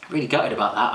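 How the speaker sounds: usual speaking voice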